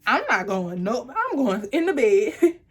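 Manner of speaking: scared backpedal. Retold or imitated. Girlfriend panicking as the boyfriend holds a knife. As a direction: backpedal fast, scared and loud. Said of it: "my whole demeanor changed".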